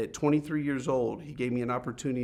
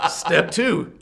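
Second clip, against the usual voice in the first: higher, louder words broken by laughter; laughing at his own cluelessness; 4 semitones higher, 10 dB louder than usual. Real, not acted. Speaker laughs recalling how lost he was, laughing.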